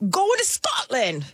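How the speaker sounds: slow, high attempt at a Scottish accent. Telling the host about her golf trip in a bad Scottish accent. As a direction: stretch it out in a put-on Scottish accent. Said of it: "Is that a Scottish accent?".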